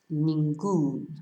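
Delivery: "ningún" stressed on the last syllable, nin-GUN; stress on word ending. Shown as a demonstration. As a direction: land firmly on the end of "ningún". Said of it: "to stress the end of the word".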